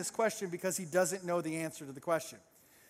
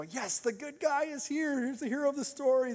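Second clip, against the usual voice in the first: high, stretched mock cheer; cheering crowd; 6 semitones higher than usual. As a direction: celebrate the hero's arrival, pitched up. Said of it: "everybody starts cheering".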